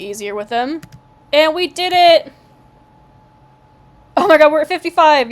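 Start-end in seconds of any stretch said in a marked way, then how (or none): 1.3-5.3 loud, high, joyful cheer; giddy relief. Real, not acted. Mate bond goal reached.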